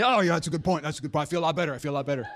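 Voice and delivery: lightly voiced, hammed-up relieved dad; mock relief, slowing at the end. Dad mock relieved by his daughter's excuse. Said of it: "calmed her dad down".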